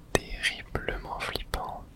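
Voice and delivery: ordinary talk, nothing marked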